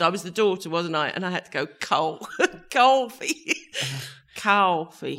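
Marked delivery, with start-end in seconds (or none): 1.8-5.2 drawn-out "cawfee" for "coffee"; attempted New York accent. Shown as a demonstration. Actress demonstrating her stage word, New York "coffee". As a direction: overdo the American "coffee", slowly. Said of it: "To try and speak American".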